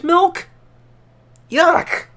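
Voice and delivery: high, loud grossed-out yuck; disgusted yuck. Real, not acted. Reviewer reacting to almond milk ice cream, disgusted and high. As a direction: spit it out in disgust.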